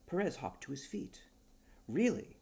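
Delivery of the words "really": high, loud eager question; excited. Acted. Perez perking up at news of a publisher, excited. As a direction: perk right up.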